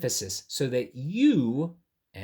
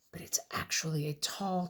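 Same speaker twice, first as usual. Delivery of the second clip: whisper; 7 dB quieter than usual. Real, not acted. Hushed aside during reading.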